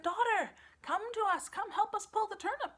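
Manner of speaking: high, loud call. Acted. Parents calling their daughter, high and loud. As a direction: call across the yard.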